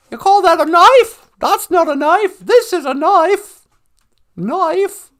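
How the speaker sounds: loud, high mock-Australian; exaggerated Australian movie-quote delivery; Australian accent. Shown as a demonstration. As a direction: put on a big hammed-up Aussie accent. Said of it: "Can you do an Australian accent?".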